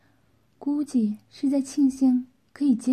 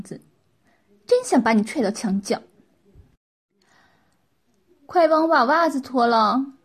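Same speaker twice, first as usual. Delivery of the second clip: demanding wife; 3 semitones higher, 6 dB louder than usual. Acted. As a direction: complain and order him about like a spoiled wife. Voices the scolding wife.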